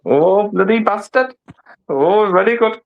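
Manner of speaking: exaggerated Indian accent with a wobble; hammed-up, drawn-out Indian accent; mock Indian accent. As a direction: put on a cartoonish Indian accent. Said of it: "get into the accent is by giving it all wobblehead".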